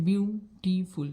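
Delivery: বিউটিফুল sounded out as biu - ti - ful; teacher breaking বিউটিফুল into three separate parts; split into syllables. Shown as a demonstration. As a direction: say বিউটিফুল one syllable at a time. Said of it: "দেখো এটা সিলেবেলে ভাঙা হচ্ছে".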